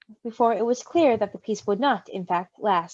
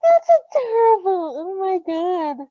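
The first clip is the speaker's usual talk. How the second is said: gushing; 8 semitones higher, 4 dB louder, slower than usual. Real, not acted. Gushing over cute story moment.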